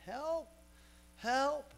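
high, strained cry for help, imitating the man in the water; drowning man crying out. Pastor voicing the drowning man calling to his friends, high cry. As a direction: call out high as if going under. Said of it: "he's crying out and said".

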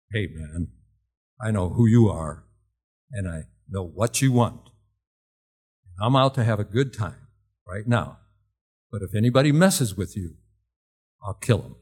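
lightly voiced street guy, low and firm; a hint of a menacing stranger. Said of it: "with a real low voice".

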